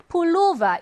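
Pullover, loud and high with LO stressed; second-syllable stress. Shown as a demonstration. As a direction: punch the middle syllable of Pullover. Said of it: "이 음절 강세어예요".

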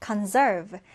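"conserve" stressed on -SERVE; stress on second syllable. Shown as a demonstration. Teacher models the new word, "conserve" with second-syllable stress. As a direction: keep con- light and hit -serve in "conserve". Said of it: "두 번째 음절에 강세 두고".

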